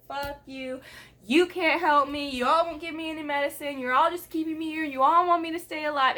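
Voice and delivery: replaying an angry outburst at staff; retold screaming. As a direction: fire it off at everyone, half-shouting. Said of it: "I was screaming at everybody".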